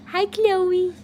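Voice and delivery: high sing-song puppy voice. Real, not acted. Talking to the sleepy puppy Chloe, high baby talk. Greeting the dog sitting puppy.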